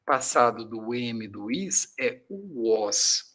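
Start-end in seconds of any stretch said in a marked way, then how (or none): 2.0-3.3 "was" said slowly with a u-like w; slow pronunciation demo. Shown as a demonstration. Teacher modelling "was" slowly for the class. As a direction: stretch out "was", starting with a u sound. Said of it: "Olha a pronúncia".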